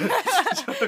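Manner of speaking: high-pitched burst of laughter. Real, not acted. Laugh after driver line.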